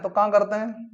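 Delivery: ordinary talk, nothing marked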